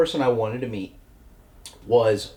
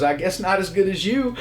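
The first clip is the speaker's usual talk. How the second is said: higher, laughing smooth line; laughing imitation; 8 semitones higher than usual. Actor flirting back, quoted with a laugh. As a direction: deliver the flirt through a chuckle. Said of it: "He goes".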